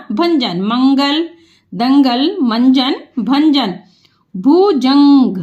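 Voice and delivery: Bhujang drawn out part by part; slow sounding out. Shown as a demonstration. Teacher to pupils, sounding out Bhujang.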